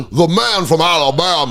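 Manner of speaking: hammed-up Southern judge calling the defendant; Southern accent, a judge grandstanding in court; mock Southern judge. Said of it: "dropped the accent".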